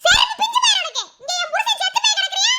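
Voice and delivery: high, fast angry shouting at him; furious outburst. Acted. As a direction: lash out at the greedy in-law. Widow furious at greedy relative. (ஆத்திரத்துடன் வேகமாக கத்துதல்)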